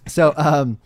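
ordinary talk, nothing marked

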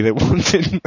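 breaks into a laugh. Real, not acted. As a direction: laugh through the words. Laughing mid-line, restarts.

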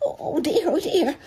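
high, quavering little whimper; frightened Piglet. A narrator's reading. Piglet fretting to himself by the trap, high and frightened. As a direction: squeak out a worried stammer. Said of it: "said Piglet to himself".

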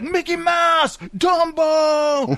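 high hammed-up yell across a gunfight; imitated high-pitched film yell; mock dubbed shouting. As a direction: shout the nicknames like an overdubbed action film. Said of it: "there's part, they're like".

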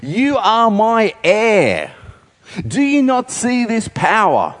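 high, slow, urgent father pleading with his son; a desperate king begging his son to understand; pleading King Saul. Acted. Saul appeals to Jonathan about his kingdom, high and pleading. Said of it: "He says".

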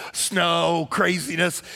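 normal talking voice

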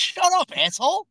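high, sharp mock yell; mock outburst. Real, not acted. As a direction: bark it playfully. Playful insult, high pitch.

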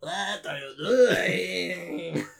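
very slow, distorted put-on voice; hammed-up copycat drawl; exaggerated mimicry. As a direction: stretch the words to absurd length. Exaggerated rival imitation of question.

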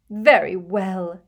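a hurt seer drawing herself up; Trelawney giving in, slow and hurt; deeply wounded. A narrator's reading. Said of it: "in a deeply wounded voice".

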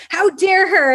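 high-pitched, mock-outraged retort; retold indignant outburst, not shouted; worked-up indignation. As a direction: act out being worked up, pitch jumping high. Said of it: "just so worked up".

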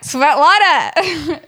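high, loud, long-drawn name; big incredulous call-out; exasperated. Retold or imitated. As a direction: stretch the name out in mock exasperation. Retold exasperated reply to customer.

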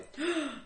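short, high gasp; sharp intake of breath, pitched high. Acted. Mock gasp before quizzing co-host.

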